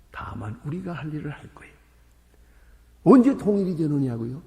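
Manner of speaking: ordinary talk, nothing marked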